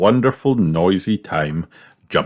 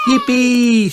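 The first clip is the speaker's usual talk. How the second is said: high, loud squeal of joy; excited shout; 4 dB louder than usual. A narrator's reading. Peppa off to jump in puddles, shouting. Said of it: "shouts Peppa".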